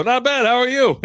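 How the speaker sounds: high, loud, laughing mock reply; hammed-up greeting. Replying to a stranger's wife, hammed up and laughing. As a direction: pitch it way up and play it big. Acting out flirty exchange, laughing.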